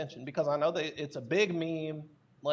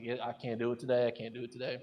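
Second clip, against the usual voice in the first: low and much softer, drained of energy; fading mumble, running out of will; low, depressed refusal; 5 semitones lower, 6 dB quieter, faster than usual. Retold or imitated. Turning down the recording while depressed, low and drained.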